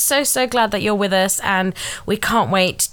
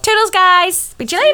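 bubbly sign-off; 11 semitones higher, 7 dB louder than usual. Real, not acted. Cheery sign-off.

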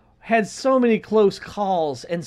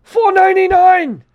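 high, loud, outraged impression; Bernie Sanders; 11 semitones higher, 8 dB louder than usual. Ranting to kids in the car about hose prices, hammed-up outrage. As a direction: rant in a put-on orator's voice, peaking on the price. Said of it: "going off in that same tone".

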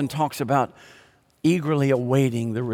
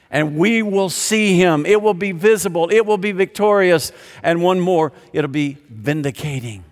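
rising proclamation; 3 semitones higher, 4 dB louder than usual. Acted. Preacher proclaiming the return to the church, rising and louder. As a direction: build the triumph line by line.

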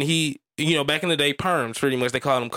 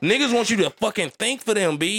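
high-pitched, incredulous telling-off; exasperated mock retort; 9 semitones higher than usual. Retelling his comeback to the critic who missed the joke, exasperated. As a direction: go off on him, pitch jumping up.

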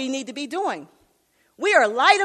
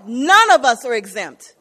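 much louder, driving the repeat home; emphatic preaching; 8 dB louder than usual. Acted. Preacher repeats to the congregation that none are exempt, loud. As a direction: hit it harder the second time.

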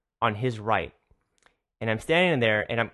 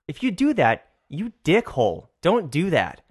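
higher-pitched, punchy telling-off; indignant scolding; 4 semitones higher than usual. Acted. Barista telling off the messy sugar customer, indignant scolding. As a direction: scold an offender in mock outrage. Angry rant at sloppy customer.

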